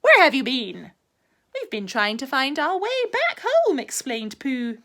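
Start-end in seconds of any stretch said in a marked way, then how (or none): 0.0-0.9 louder cheery question. A narrator's reading. Tigger asks. 1.5-3.8 higher explaining voice. A narrator's reading. Pooh explains.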